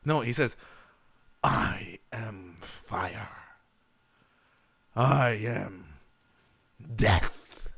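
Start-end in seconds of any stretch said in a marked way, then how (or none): 1.4-7.7 slow, low, drawn-out doom voice; each word slow and heavy like death itself; grim reaper voice. Shown as a demonstration. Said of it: "Do it that way".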